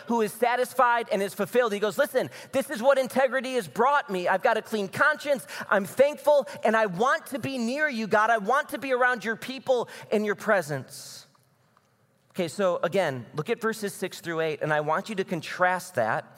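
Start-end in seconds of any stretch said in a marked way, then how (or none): 2.0-11.2 joyful. Retold or imitated. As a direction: let the happiness burst out, lifted high. Voicing joyful David.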